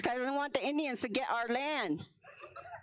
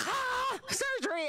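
high, alarmed gasp of surprise; shocked grandma; 12 semitones higher, slower than usual. Retold or imitated. Grandma hearing about surgery, alarmed and high. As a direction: shriek it in disbelief. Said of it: "They're like".

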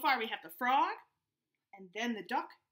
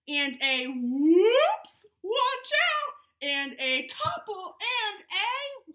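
slow, rising, louder build to the crash; building mock alarm; 5 semitones higher, 9 dB louder, slower than usual. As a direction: stretch it out, tension climbing. Building suspense in the story.

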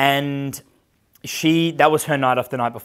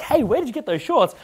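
very high mock girly voice; put-on high, curious squeal; admiring girls; 10 semitones higher than usual. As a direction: imitate excited girls asking, pitched way up. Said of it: "Girls are like".

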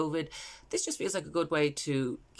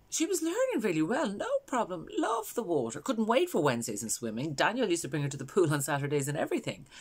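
higher, lively mother remembering happily; Valerie; 5 semitones higher than usual. Acted. Mother recalling how her daughter loved swimming, animated and high. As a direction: let the voice lift with fond enthusiasm. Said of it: "this is a character called Valerie".

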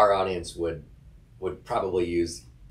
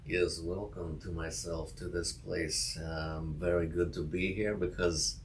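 put-on Russian accent; 4 dB quieter than usual. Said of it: "I really wanna do a Russian accent".

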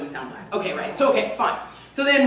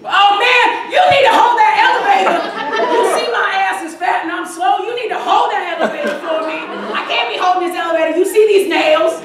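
more attitude; 6 semitones higher than usual. Pretend. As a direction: give full attitude to the crowd. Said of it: "More attitude".

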